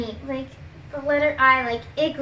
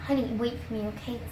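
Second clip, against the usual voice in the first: usual speaking voice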